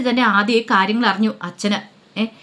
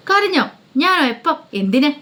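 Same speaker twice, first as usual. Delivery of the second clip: high, startled denial; mock surprise; 6 semitones higher than usual. Daughter hiding her tears, feigning surprise. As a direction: act caught off guard and deny it. Denying she was crying.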